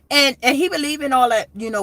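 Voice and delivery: normal talking voice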